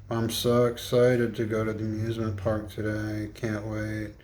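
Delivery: quiet, deflated mock excitement; sad, flat delivery. Shown as a demonstration. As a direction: say happy words in a dead, sad voice. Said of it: "Why are you so sad".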